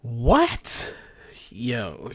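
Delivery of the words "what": loud, rising yelp of disbelief; shocked disbelief. Real, not acted. Host floored by her per-stream earnings, shocked. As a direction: blurt it out, stunned.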